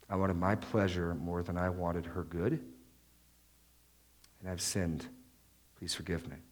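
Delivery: quiet, humble, falling to near silence; sincere confession. Retold or imitated. Husband confessing to God, quiet and contrite. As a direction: confess it low and broken, like meaning it.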